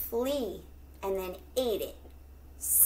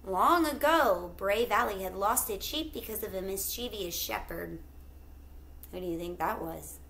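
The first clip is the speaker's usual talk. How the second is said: ordinary delivery, nothing marked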